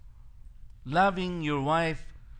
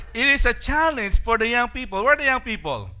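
loud, high call to the hall, laughing; 7 semitones higher, 8 dB louder, faster than usual. Real, not acted. Pastor calling out to the young people in the hall, laughing and loud.

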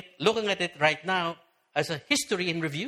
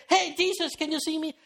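much higher, eager shout-out from the crowd; eager call; 10 semitones higher than usual. Retold or imitated. Zacchaeus waving from the crowd to Jesus, eager higher call. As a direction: wave and call to get noticed. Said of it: "he rushed up the stair".

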